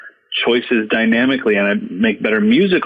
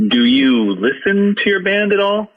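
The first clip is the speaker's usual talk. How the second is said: slow, higher-pitched mock challenge; pointed questioning; 10 semitones higher than usual. Mentor questioning a cocky young drummer, mildly incredulous.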